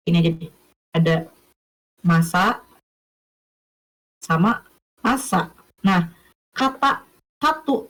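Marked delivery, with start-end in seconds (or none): 2.0-5.5 masa said slowly and carefully, slightly lower; modelling the word. Shown as a demonstration. Teacher modelling masa for students, slow and deliberate. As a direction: draw out masa so students hear both are alike. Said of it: "cara penyebutannya sama nggak?".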